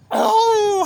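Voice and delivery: high, loud, squealing "Oh!" yelp; imitated pained yelp. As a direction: mimic a schoolmate yelping under the paddle. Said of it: "he'd take a paddling and he'd go".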